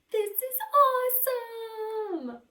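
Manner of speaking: a high, thrilled "awesome"; sudden burst of delight; excited cheer. Acted. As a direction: cheer it like a kid who just won. Amelia cheering in the story.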